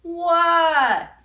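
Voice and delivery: high, loud, jolted reply; startled what. Acted. Froggy startled by his teacher, loud and high. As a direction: jump out of a daydream.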